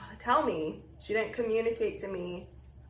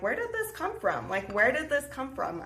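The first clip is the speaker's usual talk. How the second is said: high, incredulous question that settles back down; retelling her own shock with a high squeak; baffled disbelief; 6 semitones higher than usual. Retold or imitated. Asking herself why mom is crying, baffled. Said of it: "'cause I'm like".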